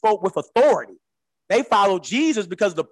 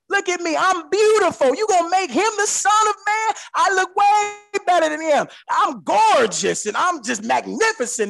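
vain devil; 11 semitones higher than usual. Acted. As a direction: crow like a preening devil who thinks he's gorgeous. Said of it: "The devil said".

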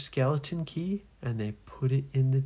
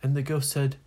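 plain reading voice

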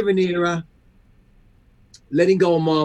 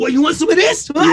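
tough guy taunt; 6 semitones higher, 5 dB louder than usual. Retold or imitated. Boston guy picking a fight.

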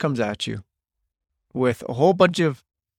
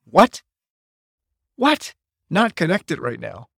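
mock-shocked father echoing his son's words; rising stunned what, then a slow repeat; retold disbelief; 3 semitones higher, slower than usual. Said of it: "and I'm thinking".

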